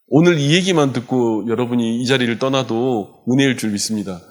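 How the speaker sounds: ordinary talk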